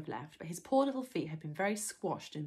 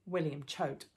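ordinary delivery

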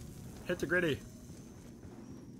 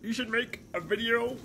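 higher, louder strained frog-puppet voice; a strained Kermit the Frog impression held through gameplay; 4 semitones higher, 4 dB louder than usual. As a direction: push the voice up and squeeze it like a felt frog. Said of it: "do the Kermit voice for two minutes".

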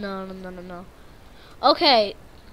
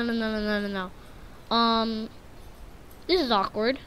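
ordinary talk

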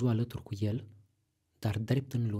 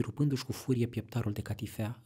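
usual speaking voice

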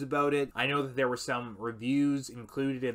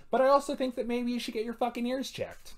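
exasperated outburst; 11 semitones higher, 4 dB louder than usual. Acted. Mock-polite insult turns heated.

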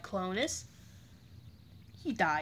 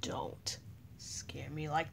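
scared whisper; 4 semitones lower, 10 dB quieter, slower than usual. Pretend. Character scared by siren.

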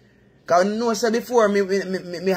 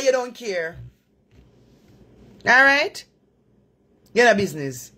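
ordinary delivery

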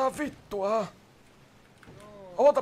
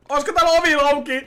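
loud, quick, higher-pitched call; raised-voice question, rattled off; 4 semitones higher, 7 dB louder, faster than usual. Pretend. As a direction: call through the building for someone. Calling out about a door.

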